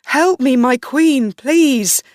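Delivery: high, loud pleading, rising to a final cry; desperate screaming. A narrator's reading. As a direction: beg for your life, building to a scream. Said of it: "he screamed".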